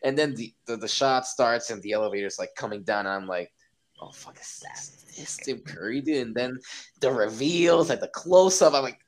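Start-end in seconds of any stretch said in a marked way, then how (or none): 4.0-6.3 mock awestruck whisper; awed whisper. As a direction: whisper it like you can't believe it. Retold awed whisper at reveal.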